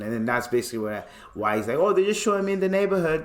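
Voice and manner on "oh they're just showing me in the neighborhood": high, quick mock British voice; British accent. Friend throwing police off, mock British accent. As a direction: breeze through it in a put-on British accent. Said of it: "he kept talking in a British accent".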